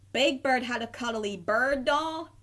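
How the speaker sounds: ordinary delivery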